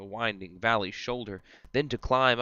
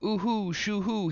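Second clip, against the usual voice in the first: high hooting owl call, much higher; mock owl calls pitched high; owl hoots; 10 semitones higher than usual. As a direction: hoot like owls in the night wood. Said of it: "hear them call".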